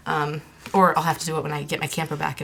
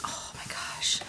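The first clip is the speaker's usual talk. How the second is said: soft whispered amazement; hushed awe; 8 dB quieter, much slower than usual. Real, not acted. Vlogger marveling at a wallpaper sample, hushed awe.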